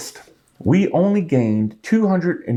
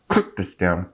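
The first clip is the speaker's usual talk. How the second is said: ordinary talk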